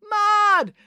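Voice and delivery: very high, held chant; crowd bellow. A narrator's reading. Whole crowd answering Brad, bellowing. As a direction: answer as one excited crowd. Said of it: "The whole crowd bellowed as one".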